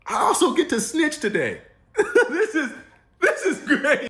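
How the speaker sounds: gleeful laugh. Real, not acted. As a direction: giggle through the thrill of snitching. Gleeful about snitching.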